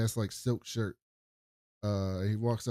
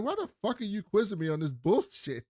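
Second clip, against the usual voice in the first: mock irritated outburst; 9 semitones higher than usual. [name] annoyed at the quiz.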